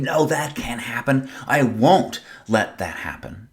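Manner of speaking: ordinary delivery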